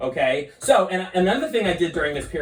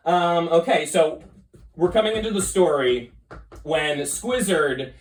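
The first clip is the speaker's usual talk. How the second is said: normal talking voice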